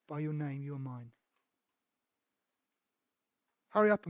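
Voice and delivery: soft and gentle, a hint of God speaking; tender divine voice. God reassuring his people, softly tender. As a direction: speak it quietly, like a loving whisper of reassurance.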